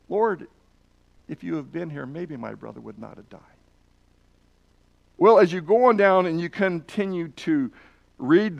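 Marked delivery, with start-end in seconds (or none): 0.1-3.4 much softer, falling into a hushed sorrow; grieving softly. Shown as a demonstration. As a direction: let it sink away in quiet grief. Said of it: "Or do you think it could have been".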